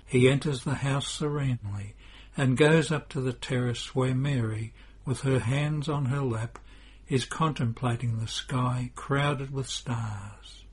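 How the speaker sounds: ordinary delivery, nothing marked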